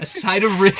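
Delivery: loud, punchy shout of the phrase; excited shout. Acted. Hyping up a friend's joke with a loud shout. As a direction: belt it out, laughing.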